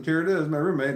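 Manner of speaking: normal talking voice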